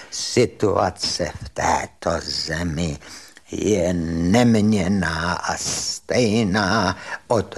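very slow, low, tremulous professor impression; solemn address in an imitated trembling voice; V. V. Štech. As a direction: mimic a quavering lecturer, stretching every syllable. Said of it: "mluvil takovým roztřeseným hlasem".

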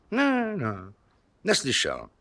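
high-pitched little denial; squirming, high-voiced no; evasive denial. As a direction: deny it far too quickly in a high put-on voice. Suspect denies hearing anything.

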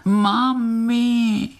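high, far-off crying for mum; a tiny voice calling from under the stone; thin wail. A narrator's reading. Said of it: "jako by někdo křičel". (tenounké volání)

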